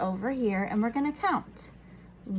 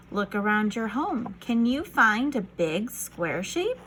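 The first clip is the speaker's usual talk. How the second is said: usual speaking voice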